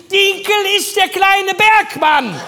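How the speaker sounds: laut, hoch, langsam gerufen; Helga von hinten. Retold or imitated. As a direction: gedehnt von hinten durch den Laden rufen. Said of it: "Helga von hinten". (ruft laut und gedehnt von hinten)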